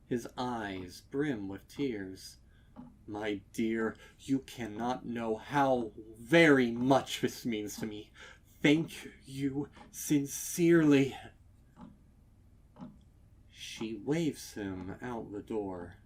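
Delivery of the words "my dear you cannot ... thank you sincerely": touched, loud and sincere; thanking her from the heart; grateful old stallion. A narrator's reading. Customer thanking the mayor, moved and sincere.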